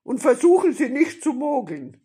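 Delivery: loud, high bandit shout; warning robber. A narrator's reading. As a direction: bellow a last warning.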